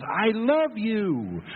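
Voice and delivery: high, slow called-out I love you; sweet yelled love. Retold or imitated. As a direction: sing it out to the canyon, slow and high.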